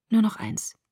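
soft, hushed plea; quiet, half-whispered request; whispering. A narrator's reading. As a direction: say it under your breath. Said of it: "flüsterte ich".

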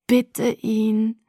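mildly imploring, held out; pleading. A narrator's reading. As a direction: urge them to beg. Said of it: "bat meine Halluzination". (leicht flehend)

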